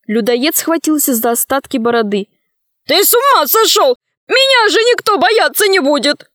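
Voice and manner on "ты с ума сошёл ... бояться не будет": very high, outraged wail; high-pitched indignant outburst; alarmed protest. A narrator's reading. Cannibal protests his cut beard.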